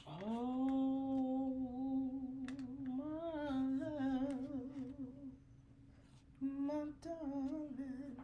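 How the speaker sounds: slow, swooning lover's sigh; lightly hammed lover's plea; mock romantic. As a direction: drag it out like a hammed-up love letter. Reading a romantic line dramatically.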